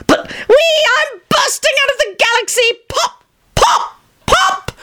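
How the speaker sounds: very high, slow, giddy near-shrieking; giddy euphoria. A narrator's reading. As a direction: float away in mad delight. John soaring.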